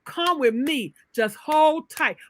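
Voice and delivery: mother calling out encouragement to her son; urging mother. Acted. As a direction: coax him through it, loud and high. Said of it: "she was on that ground saying".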